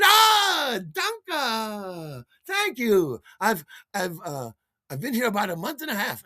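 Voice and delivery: put-on very high German-accented woman; Lisbeth's German accent. German woman thanking the storyteller, high accented voice. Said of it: "did I detect an accent".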